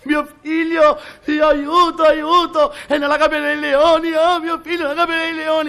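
high, loud cries for help; a panicked mother screaming for her son; mother shrieking at the lion cage; terrified mother. Acted. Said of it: "La madre è spaventatissima".

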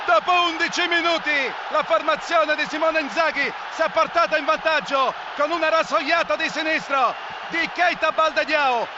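ordinary talk, nothing marked

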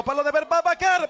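normal talking voice

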